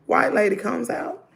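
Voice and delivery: usual speaking voice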